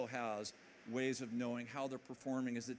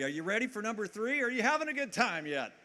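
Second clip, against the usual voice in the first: high, loud call to the crowd; crowd hype; 8 semitones higher, 8 dB louder than usual. Real, not acted. Speaker firing up the conference audience, high and loud. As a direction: rev up the room.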